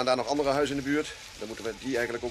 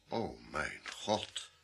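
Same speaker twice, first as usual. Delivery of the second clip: very slow, low, soft horror; hushed shock; 7 semitones lower, 9 dB quieter, much slower than usual. Acted. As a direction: let it sink in, drawn out and low. Inspector sees the murdered woman. (ontzet, traag en gedempt)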